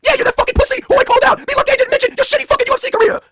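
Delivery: high, loud woken-up yell; mock Braun shout. Braun answers the late call, high loud yell. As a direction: bellow it like you just got woken. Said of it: "saying impression".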